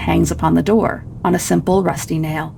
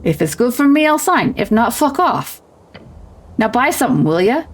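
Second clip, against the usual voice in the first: goblin trader working up to a higher pitch; irritated goblin seller; 3 semitones higher than usual. Acted. Goblin telling a customer to buy or leave, irritated. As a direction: rattle off slangy goblin grumbles, rising in irritation.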